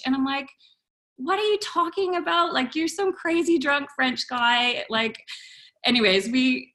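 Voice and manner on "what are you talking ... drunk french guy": higher, disbelieving mock protest; baffled comeback, acted out; incredulous retort. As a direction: question him as if he's crazy.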